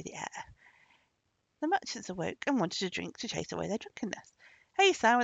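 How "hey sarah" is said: loud, high call; demanding summons; crying out. A narrator's reading. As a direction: shout for the servant. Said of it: "they cried".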